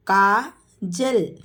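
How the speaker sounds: Kajal drawn out slowly; slow teacher model of Kajal. Shown as a demonstration. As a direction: stretch Kajal syllable by syllable. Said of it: "teacher models name slowly".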